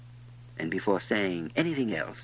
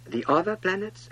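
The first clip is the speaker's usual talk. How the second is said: ordinary delivery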